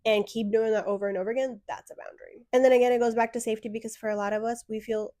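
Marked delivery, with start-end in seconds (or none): 1.7-2.5 a hushed knowing whisper; whispered aside. Real, not acted. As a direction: drop to a whisper for the punchline. Punchline after advice on boundaries.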